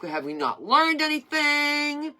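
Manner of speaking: loud, high, slow outcry of disbelief; exasperated plea. Acted. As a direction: cry out at humanity, drawing it out. Exasperated plea to humanity about wars.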